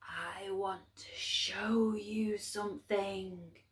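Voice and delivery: slow hushed whisper, cold and distant; whispering dark. A narrator's reading. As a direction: breathe it like the dark in the night. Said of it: "the voice seemed very far away".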